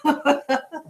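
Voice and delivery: short laugh at own fear; high amused chuckle; self-mocking laugh. Real, not acted. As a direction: laugh at the memory. Laughing at herself.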